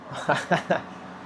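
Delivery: quick airy chuckle; laugh. Real, not acted. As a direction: laugh softly at the question. Laughs at accent question.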